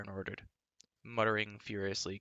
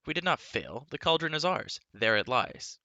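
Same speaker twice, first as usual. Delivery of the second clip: louder, proud defiance; defiant; 6 semitones higher, 5 dB louder than usual. Acted. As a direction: declare it proudly. Terran defiant.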